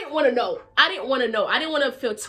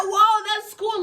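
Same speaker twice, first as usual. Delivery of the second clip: high, loud wowed reaction; retold excitement; 11 semitones higher, 6 dB louder, slower than usual. Teller quotes her reply about twin brothers, excited. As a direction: act out being impressed, pitched up.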